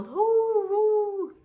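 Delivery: loud high "hoo" like wind; wind howl. A narrator's reading. Imitating howling wind in story.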